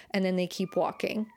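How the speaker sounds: ordinary talk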